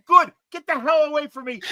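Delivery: high, loud angry retort, re-enacted; screaming back. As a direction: act out yelling back at someone storming off. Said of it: "I'm screaming back at him".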